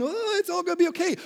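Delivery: high, fast, sing-song mockery; mocking hippie. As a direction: parody a carefree hippie. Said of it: "crazy hippie".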